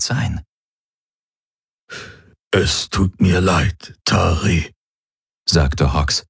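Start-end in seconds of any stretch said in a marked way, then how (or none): 2.5-4.7 slow, effortful apology; strained apology. Acted. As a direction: force the apology out slowly. Injured captive apologises to attacker.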